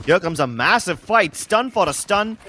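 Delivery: loud, hyped fight call; excited caster call. Real, not acted. Caster calling a big fight.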